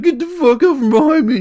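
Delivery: high, very loud mock-furious yell; imitated angry screaming. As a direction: yell it like a brother mid-fight. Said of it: "I can still hear him crying, screaming".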